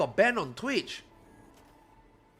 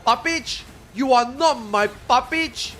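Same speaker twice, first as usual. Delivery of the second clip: slow, higher, louder mock viewer whine; mocking Russian viewers; 7 semitones higher, 6 dB louder, slower than usual. Streamer mocks Russian fans calling him fake, slow mock whine. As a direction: parody the accusing fans, drawn out. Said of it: "Russian viewers that is like".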